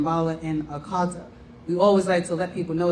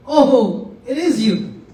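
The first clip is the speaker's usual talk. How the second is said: louder, sudden realisation; the penny drops all at once; a delighted gasp of recognition; surprised recognition; 3 semitones higher, 7 dB louder, much slower than usual. Retold or imitated. Disciples recognise Jesus.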